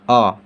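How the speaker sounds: अ said short and clear; letter sound demo. Shown as a demonstration. Teacher modelling अ for beginners, short and clear. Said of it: "इसका उच्चारण क्या हो जाएगा".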